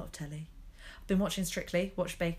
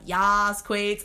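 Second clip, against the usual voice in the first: loud, whooping cheer; cheering yes queens; 3 semitones higher, 7 dB louder than usual. Retold or imitated. Scene: a fan cheering the queens on TV, whooping loud. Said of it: "I'm just like".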